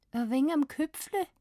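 put-on high, dazed Swabian child voice; Swabian girl. A narrator's reading. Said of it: "auf einmal Schwäbisch". (benommenes Mädchen auf Schwäbisch)

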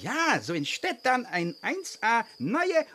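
put-on broken German with Hungarian accent. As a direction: speak like a Hungarian composer.

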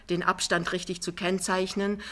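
ordinary delivery, nothing marked

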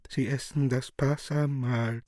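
chorus chiming in. Acted. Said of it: "Chor der Apostel ... einfallend".

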